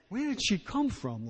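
high, hushed amazement; awed disbelief; amazed wonder. Retold or imitated. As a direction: marvel at where she came from.

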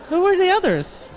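incredulous, high. Acted. Repeated punchline, much higher.

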